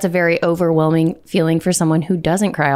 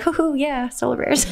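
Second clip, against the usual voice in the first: hooting mock cheer for the team; a playful hoo-hoo cheer; teasing fan cheer; 7 semitones higher, 4 dB quieter than usual. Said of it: "I was saying, like, "Oh, yeah".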